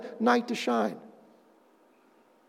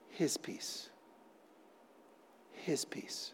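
whispered "his peace"; 4 semitones lower, 22 dB quieter, much slower than usual. Acted. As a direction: breathe the words, barely voiced. Intimate repeated phrase.

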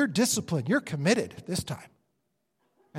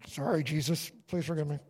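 sheepish apology; 5 semitones lower, 5 dB quieter than usual. Acted. Believer caught sinning, apologizing.

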